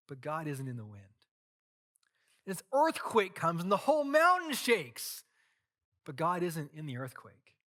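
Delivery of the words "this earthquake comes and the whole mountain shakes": louder and higher, shaking the room; dramatic narration. A narrator's reading. Preacher describing the earthquake, loud and high. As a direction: throw the earthquake at them.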